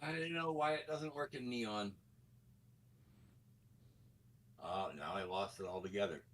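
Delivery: distant, faint, muttered far off; speaking from another room; in the other room. Real, not acted. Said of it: "you sound like you're in the other room".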